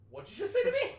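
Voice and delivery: high, loud, incredulous squawk; very high-pitched mock bafflement; baffled disbelief. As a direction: blurt it out in hammed-up bewilderment. Baffled customer reacts to gibberish.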